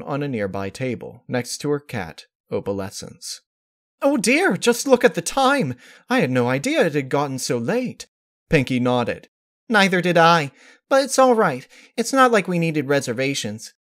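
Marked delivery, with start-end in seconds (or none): 4.0-8.1 high, genteel fluster; fussy Rarity voice. A narrator's reading. Rarity noticing the late hour, genteel fluster. 9.7-13.7 high, bright and bouncy pony; bubbly Pinkie voice. A narrator's reading. Pinkie answering Rarity, high and bubbly.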